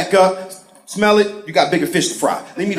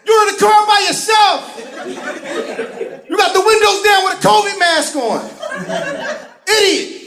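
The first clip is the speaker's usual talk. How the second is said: yelling out the car; 12 semitones higher than usual. Retold or imitated. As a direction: shout it up high at the masked idiot. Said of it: "Driving like".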